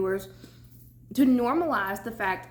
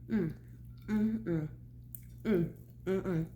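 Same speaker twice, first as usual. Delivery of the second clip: appreciative mm while chewing; 6 dB quieter than usual. Real, not acted. Tasting food in mukbang.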